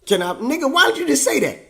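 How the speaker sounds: high, loud, hammed-up disbelief; exasperated demand. Son demanding why dad didn't say so, exasperated. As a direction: burst out with the question, pitch leaping.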